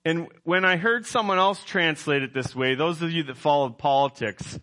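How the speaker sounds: usual speaking voice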